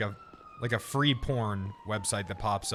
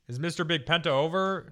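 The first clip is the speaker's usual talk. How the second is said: ordinary talk, nothing marked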